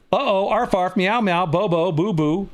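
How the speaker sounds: ordinary delivery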